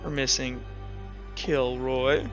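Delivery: normal talking voice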